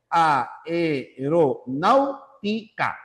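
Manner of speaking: "Aeronáutica" slowly split into a-e-ro-náu-ti-ca; syllable by syllable. Shown as a demonstration. Teacher proving six syllables, "Aeronáutica" slowly syllable by syllable. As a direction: separate every syllable, A apart from E in "Aeronáutica". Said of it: "la A se separa de la E".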